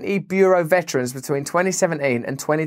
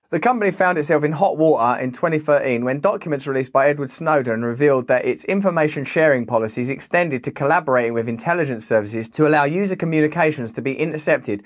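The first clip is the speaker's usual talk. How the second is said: usual speaking voice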